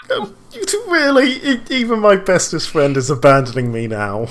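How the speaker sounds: high, loud mock wailing. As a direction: wail in betrayed mock despair. Character wails at being abandoned.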